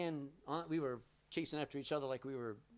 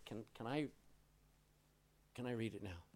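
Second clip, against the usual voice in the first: timid request; 4 semitones lower, 8 dB quieter than usual. Retold or imitated. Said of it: "with tears in his eyes".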